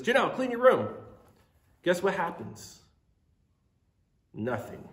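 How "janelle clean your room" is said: put-on higher, louder bossy kid voice; kid brother calling. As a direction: yell it up the stairs like a little brother. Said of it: "Mason runs upstairs and says".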